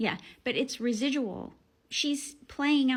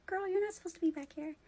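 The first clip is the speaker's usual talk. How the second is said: higher, quicker mock scolding; 7 semitones higher, faster than usual. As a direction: tease a child who sneaked in. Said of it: "I said out loud".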